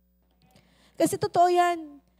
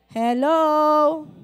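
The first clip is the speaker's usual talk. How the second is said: calling out; 7 dB louder than usual. Real, not acted. Calling out to congregation.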